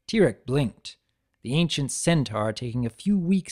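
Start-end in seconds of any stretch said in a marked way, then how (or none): none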